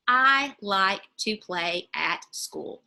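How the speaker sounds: "I like to play at school." with each word set apart slowly. Shown as a demonstration. Teacher to parents showing word counting, slow and separated. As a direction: spell out every word slowly so a child can count them. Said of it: "And they can count those words".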